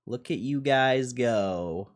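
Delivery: normal talking voice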